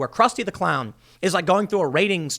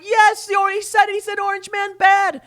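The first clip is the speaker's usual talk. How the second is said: much higher, louder mock shout; mock screaming crowd; 13 semitones higher, 4 dB louder than usual. The audience cheering the host's jab, a high mock shout. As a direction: scream it like an overexcited fan. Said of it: "They start screaming and clapping".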